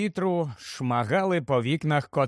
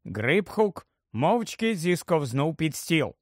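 plain reading voice

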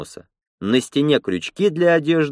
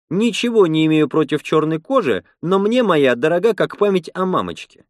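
lightly voiced woman, pitched higher; Lena, indignant and joking; 6 semitones higher than usual. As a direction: raise the pitch for her wry protest.

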